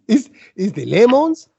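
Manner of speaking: badly faked Italian accent, questioning lilt. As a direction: imitate an Italian host, unconvincingly. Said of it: "That sounds French, isn't it?".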